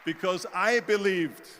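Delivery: loud, slow, drawn-out conviction; emphatic declaration. Real, not acted. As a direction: proclaim it forcefully to the crowd. Speaker declares self-belief to audience.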